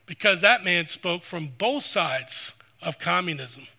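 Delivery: ordinary delivery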